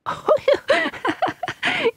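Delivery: mock children's laughter. A narrator's reading. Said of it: "children shouted with laughter".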